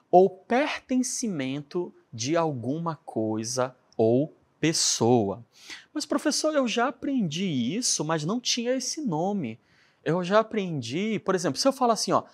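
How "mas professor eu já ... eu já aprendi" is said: voz mais aguda de aluno intrigado; objeção de aluno ao professor; aluno questionando. Acted. (aluno confuso, em tom agudo)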